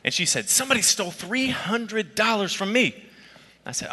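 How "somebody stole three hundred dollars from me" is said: high-pitched indignant complaint; outraged. Retold or imitated. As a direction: imitate an outraged sister, voice pitched way up. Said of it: "she was absolutely outraged".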